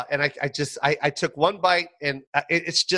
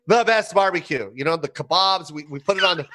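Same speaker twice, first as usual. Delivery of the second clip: usual speaking voice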